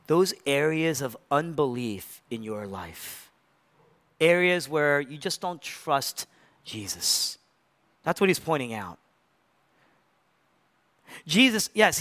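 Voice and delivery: ordinary delivery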